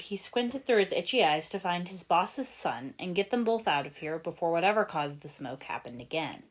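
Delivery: plain reading voice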